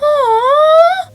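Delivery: high, squeaky sympathetic aww; a sappy cooing aww as if moved to tears; mock-tearful aww. Real, not acted. Narrator reacting to a sad scene with a high tearful aww.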